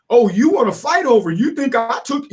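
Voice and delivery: puffed-up, imitated indignation; indignant. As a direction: act offended at being accused. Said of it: "they get all indignant".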